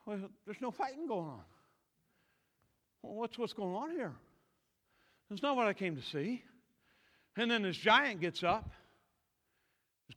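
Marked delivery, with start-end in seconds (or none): 0.1-6.4 soft, deflated puzzlement; mock letdown. David finding no fighting going on, quietly let down. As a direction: drop the energy, quietly baffled.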